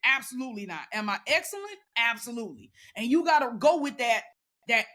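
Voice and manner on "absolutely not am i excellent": ordinary talk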